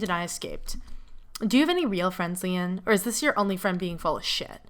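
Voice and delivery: usual speaking voice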